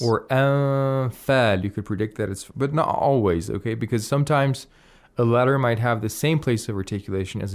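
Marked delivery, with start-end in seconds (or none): 0.3-1.6 "anfal" with a drawn-out nasal "n"; nasalized ikhfa. Shown as a demonstration. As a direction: hold the "n" of "anfal" nasally toward the "f". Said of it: "just by the ikhfa".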